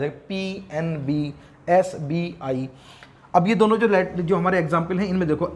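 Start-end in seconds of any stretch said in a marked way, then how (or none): 0.0-2.7 "पीएनबी, एसबीआई" said slowly, each letter separate. Shown as a demonstration. Teacher shows students abbreviations letter by letter, slow and deliberate. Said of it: "हर एक letter को अलग-अलग बोला जाता है".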